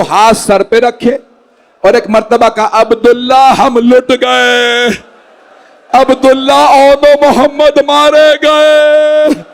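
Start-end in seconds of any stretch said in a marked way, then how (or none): 2.7-9.4 drawn-out grieving call; slow lament. Acted. Zainab telling Abdullah their sons are dead, drawn-out lament. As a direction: stretch each word in sorrow.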